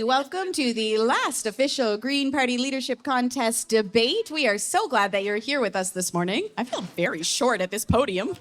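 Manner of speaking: usual speaking voice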